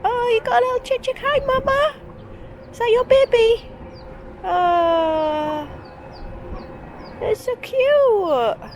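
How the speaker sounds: high cooing voice to a chick. Real, not acted. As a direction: coo at the little chick.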